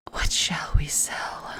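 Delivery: slow, soft near-whisper; odd hushed musing. Real, not acted. Player wondering aloud what to sell, oddly hushed and slow. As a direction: whisper it slowly, pondering. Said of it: "I don't know why I said it like that".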